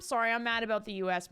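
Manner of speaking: ordinary delivery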